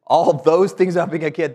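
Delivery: ordinary talk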